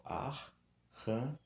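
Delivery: "arranha" drawn out as ar, ra, nha; slowly part the double R in "arranha"; syllable by syllable. Shown as a demonstration. Teacher showing double R splits across syllables, "arranha" drawn apart. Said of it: "Quando eu pronuncio".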